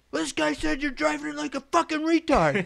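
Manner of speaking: high, loud retold accusation; imitated angry foreman. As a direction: chew him out like the boss.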